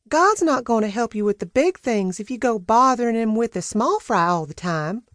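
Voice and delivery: put-on high, bossy old housekeeper, wagging a finger; Peaches' scolding voice. Housekeeper chiding Sam over the dinner table, high and bossy.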